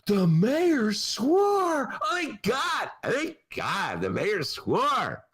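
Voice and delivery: high, slowed mock delight; mock thrilled gasping, pitched high and drawn out; mock press excitement. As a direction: gush like giddy reporters.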